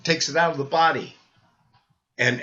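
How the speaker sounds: ordinary talk, nothing marked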